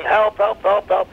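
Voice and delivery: imitated high-pitched calls for help; mock screaming for help. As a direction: cry for help, acted but not full force. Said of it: "he starts screaming".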